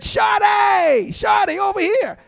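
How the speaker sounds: loud, very high shout across the yard; high yelled "Shawty, Shawty"; calling out. Acted. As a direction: hail someone from a distance. Someone calling Noah over.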